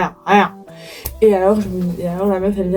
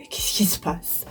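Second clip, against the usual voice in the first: whispering; 3 semitones lower, 12 dB quieter, slower than usual. Retold or imitated.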